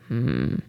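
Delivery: deep disapproving hm; low grumbling hum. Acted. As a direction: rumble it low in the chest. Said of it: "brummte Herr Koriander".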